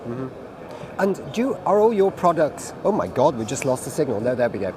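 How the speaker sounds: usual speaking voice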